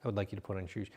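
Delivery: low, soft and level; patient, quiet firmness; calm request. Shown as a demonstration. Coach showing a parent calm request about shoes, low and soft. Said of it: "better response".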